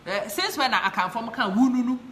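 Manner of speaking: ordinary talk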